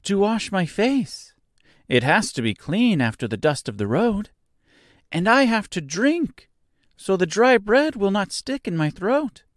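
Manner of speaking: high, light voice for a straw man; Scarecrow voice. A narrator's reading. Scarecrow pointing out a cottage to Dorothy, high and light. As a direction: play the cheerful Scarecrow up high.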